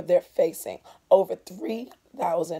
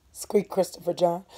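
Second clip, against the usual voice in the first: plain reading voice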